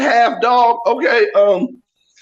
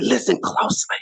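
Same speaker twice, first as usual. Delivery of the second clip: softly whispered instruction; 3 semitones higher, 4 dB quieter than usual. Retold or imitated. Father whispering to his kids in the car. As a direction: hush them to listen. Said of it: "I said".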